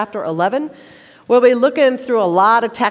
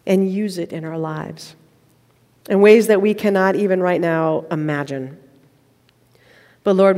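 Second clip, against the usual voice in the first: prayer; 4 semitones lower than usual. Acted. Pastor leads congregation in prayer.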